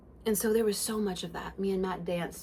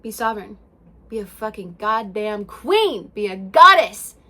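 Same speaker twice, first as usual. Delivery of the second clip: rallying cry; 11 dB louder than usual. Real, not acted. Urging listeners to be goddesses, rising to a loud call. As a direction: proclaim it like crowning yourself.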